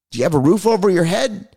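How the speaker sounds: ordinary talk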